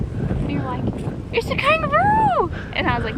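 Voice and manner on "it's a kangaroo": much higher, excited squeal; mock excitement. Retelling his friend spotting a deer, mock excited. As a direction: yelp it like spotting something amazing.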